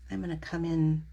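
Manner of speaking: normal talking voice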